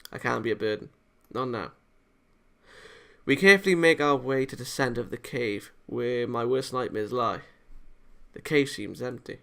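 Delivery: ordinary talk, nothing marked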